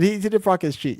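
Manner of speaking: normal talking voice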